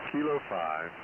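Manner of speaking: usual speaking voice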